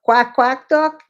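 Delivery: high, loud mock quack; duck quack. Imitating a duck for her dog.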